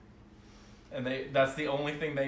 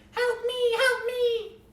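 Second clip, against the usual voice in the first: very high, loud squeaky fairy voice; the GM voices fairies screaming for help, very high and squeaky; tiny fairy cry; 8 dB louder, much slower than usual. Acted. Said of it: "the fairies will be like".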